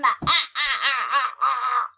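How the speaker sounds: loud mock evil cackle; punched-out staccato villain ha-has; villain laugh.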